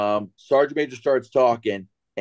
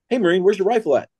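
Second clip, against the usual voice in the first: lightly acted, raised-pitch demand; mock challenge; 5 semitones higher, 3 dB louder, faster than usual. Staff sergeant asking a fainted Marine about his rifle, mock challenge. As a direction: call out to the Marine, half in earnest.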